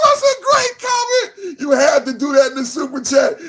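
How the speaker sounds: squealing praise; high excited shout. Real, not acted. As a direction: shriek your approval.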